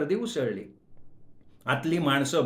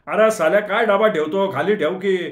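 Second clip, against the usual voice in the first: much louder, higher cursing yell; abusive shout; 5 semitones higher, 8 dB louder than usual. Acted. The same man screams at the narrator to put the box down, loud and abusive. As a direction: bark at the fool with the box. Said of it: "परत किंचाळी".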